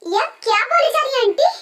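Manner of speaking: shocked anger. Acted. Bride shocked the wedding is off.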